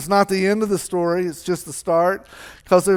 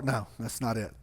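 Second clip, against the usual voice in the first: ordinary talk, nothing marked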